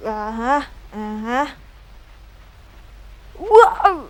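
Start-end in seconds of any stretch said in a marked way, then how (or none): 3.5-4.0 high, louder wow of wonder; excited wow. Real, not acted. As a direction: let the wow jump up. Sees something in game. (ว้าวด้วยความตื่นเต้น)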